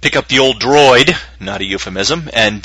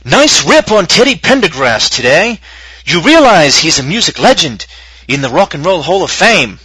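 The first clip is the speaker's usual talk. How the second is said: slow, high, louder pompous reading voice; fancy dramatic reading; 7 semitones higher, 5 dB louder than usual. Acted. Dramatic reading of an angry listener email, pompous and slow. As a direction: read it like a grand dramatic recital. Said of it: "render it in a fancy voice".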